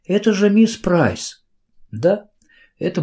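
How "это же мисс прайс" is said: high, slow, amazed recognition; can't believe who it is; surprised exclamation, pitched well up; astonished. A narrator's reading. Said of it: "голос её дрогнул от удивления".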